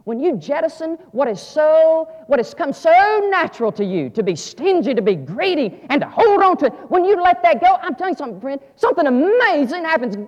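loud, high, ringing preacher's call; climbing sermon cry; full-force preaching. Acted. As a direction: preach it out to the back row.

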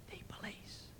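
tiny hushed whisper; whispering child. Acted. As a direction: whisper it secretly like a little kid. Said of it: "Still whispering, the voice said".

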